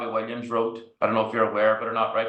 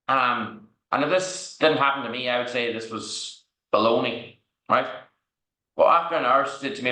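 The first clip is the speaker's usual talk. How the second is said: normal talking voice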